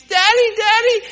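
put-on boy crying for daddy in the storm; crying child. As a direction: play the scared little son calling his dad. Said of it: "He's crying, he's crying".

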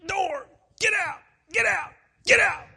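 high, loud strangled shout; agitated shouting. Retold or imitated. Dying husband throwing preacher out, agitated shouting. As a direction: imitate forcing out each furious command separately. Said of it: "began to get a little bit agitated".

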